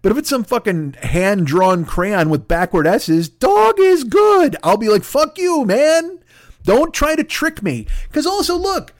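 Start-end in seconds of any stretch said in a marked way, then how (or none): 3.3-4.6 high, loud, drawn-out mock reading; childish crayon-note voice. Childish crayon note read aloud. 5.0-7.8 high-pitched, indignant telling-off; mock indignation. Imagined angry reply to fake paperwork.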